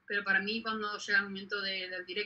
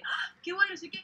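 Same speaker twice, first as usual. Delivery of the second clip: euphoric quote; 9 semitones higher than usual. Retold or imitated. Reliving post-show euphoria.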